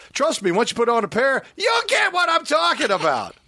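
hammed-up pitchman shout. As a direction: shout the promise to the listeners. Ad read peaks.

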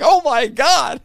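loud, high-pitched exclamation; retold astonished reaction. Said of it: "I remember being like".

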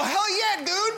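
high, hyped-up cheer; rowdy cheer. Acted. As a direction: whoop it like a rowdy fan. Rowdy drunk fan cheering.

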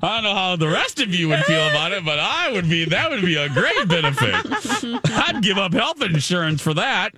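high-pitched, laughing delivery; amused joking. Real, not acted. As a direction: keep the joke going through laughter. Joking on amid group laughter.

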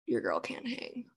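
whispered self-mockery. Real, not acted. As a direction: whisper it to yourself. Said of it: "self-deprecating aside".

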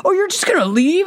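high-pitched incredulous question; mock disbelief. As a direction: imitate a man stunned at being left. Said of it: "couldn't believe it".

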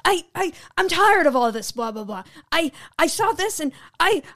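lightly mocked exasperated wrestler; sputtering, repeating the first word; exasperated wrestler promo. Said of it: "act really exasperated".

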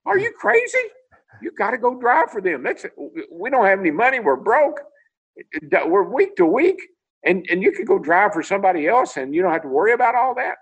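mock frantic wife, we're broke, go get that job; excited wife. Wife insisting he take the job, imitated high and urgent. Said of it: "she got all excited".

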